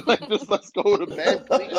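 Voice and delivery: pleading through real laughter; laughing. Real, not acted. Voicing herself to drunk Katie, laughing. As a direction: laugh out the plea to go to bed.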